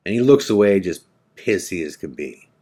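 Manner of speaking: ordinary talk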